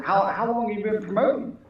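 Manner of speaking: imitating a polite woman anchor; female anchor. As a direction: play the confused lady anchor. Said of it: "looked at me and said".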